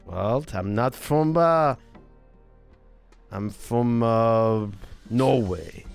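put-on slow, halting Norwegian accent. Newcomer explaining he lives in Nottingham, slow Norwegian accent. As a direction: leave long gaps in Norwegian-accented English. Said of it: "you still have a little bit of the Norway accent".

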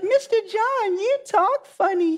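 put-on high squeaky child teasing; sing-song little kid voice; mocking kid. Kid telling the teacher he talks funny, squeaky childish voice. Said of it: "They're like".

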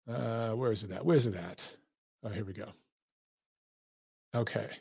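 low, very soft aside; talking to himself. Real, not acted. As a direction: mumble to yourself while searching. Searching screen for tweet, talking to himself.

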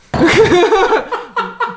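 loud, high laugh; real laughing fit; burst of laughter. Real, not acted. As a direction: crack up out loud. Laughing at her own answer.

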